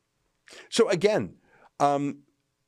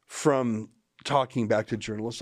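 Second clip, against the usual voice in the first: ordinary talk, nothing marked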